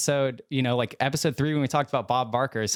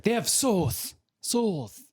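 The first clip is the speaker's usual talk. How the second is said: high lisping voice; 10 semitones higher, much slower than usual. Retold or imitated. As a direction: put on a lisp. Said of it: "he's like, that's what he has".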